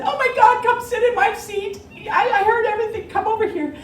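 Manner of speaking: very high, loud, gushing woman; a passenger gushes, offering Karen her seat; gushing lady. Retold or imitated. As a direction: play the eager lady giving up her seat.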